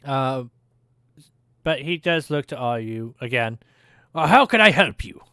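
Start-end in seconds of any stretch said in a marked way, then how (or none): 4.1-5.2 hearty dwarf, louder; dwarf smith asking. Acted. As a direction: lean over the counter and offer help. Dwarf smith asks again.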